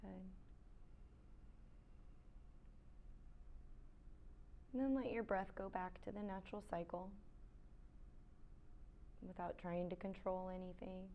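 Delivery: soft, unhurried yoga-teacher voice with long pauses; quiet meditation cues, spaced out; guided meditation. Real, not acted. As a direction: guide them gently into stillness.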